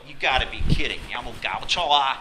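exasperated, loud and quick; ticked off. Acted. Driver griping at the red light, ticked off. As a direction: fire it back, fed up. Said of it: "you're ticked off at this".

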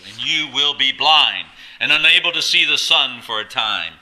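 loud, high pronouncement of judgment; forceful rebuke. Acted. Paul condemning Elymas to blindness, forceful rebuke. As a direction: proclaim the sentence with full force. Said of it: "He said it with some force".

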